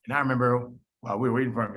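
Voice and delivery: ordinary talk, nothing marked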